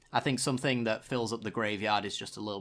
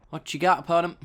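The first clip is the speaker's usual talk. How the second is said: louder, cocky call-out to the opponent; taunting challenge; 6 semitones higher, 7 dB louder than usual. Real, not acted. Streamer taunts his opponent, a louder cocky challenge. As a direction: dare the opponent to show their card.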